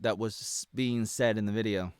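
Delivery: ordinary talk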